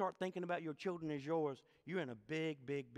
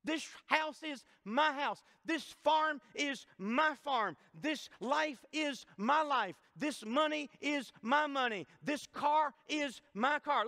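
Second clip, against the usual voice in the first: slow, higher, rhythmic chant of each line; preaching cadence; 6 semitones higher, slower than usual. Acted. Preacher chants possessive list.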